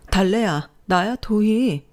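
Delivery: lifted, delighted hello; excited reunion. A narrator's reading. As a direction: call out to a long-lost friend. Old friend calls her by name.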